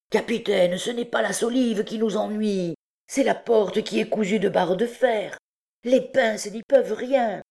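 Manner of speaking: high-pitched, insistent character voice; protesting truand. A narrator's reading. Truand explaining the iron-bound door to his captain, protesting. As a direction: plead your case to the captain.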